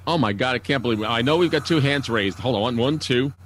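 normal talking voice

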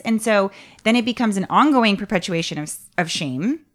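usual speaking voice

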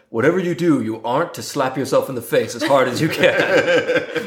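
drawn-out, theatrical professor's impression; Alan Rickman as Snape. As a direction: deliver it with mock-stern, measured pomp. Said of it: "really good Alan Rickman impression".

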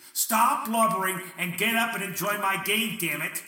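loud, barking scold voiced for God; mock tender, really scolding. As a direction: snap it at the newborn like an impatient boss. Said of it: "and tenderly say".

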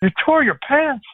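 mock incredulous reply; incredulous question. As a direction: ham up the disbelief. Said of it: "He goes".

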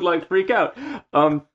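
ordinary talk, nothing marked

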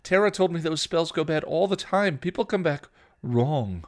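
loud, high, urgent scolding; heated warning. A narrator's reading. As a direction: warn her angrily. Sisters quarrel, scolding continues.

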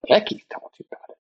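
high, breathless, rushed whisper of disbelief; whispered astonished echo; stunned disbelief. Retold or imitated. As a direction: gasp it out as if floored. Retold shock at friend's news.

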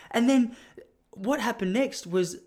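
ordinary talk

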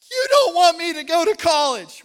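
put-on high, loud sobbing cry of a small boy; sobbing child. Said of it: "he's crying".

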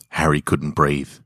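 ordinary delivery

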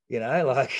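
ordinary delivery

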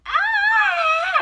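put-on high, loud shriek of a possessed girl; a sudden loud high scream from the girl; retold scream. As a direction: shriek it like she just snapped. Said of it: "starts screaming".

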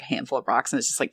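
ordinary talk, nothing marked

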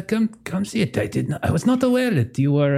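put-on fumbled British Scottish accent. Game master as Mac waving a guest over, wobbly British Scottish accent. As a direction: attempt a British Scottish voice, not quite landing it. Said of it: "like, a British Scottish accent".

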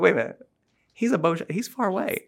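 acted-out balking at an order; mock protest. Acting out Hagar's protest to God.

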